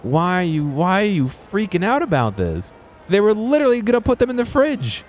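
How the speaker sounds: yelling at the restocker in disbelief; retold disbelief. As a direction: shout it in baffled disbelief. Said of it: "but I was like".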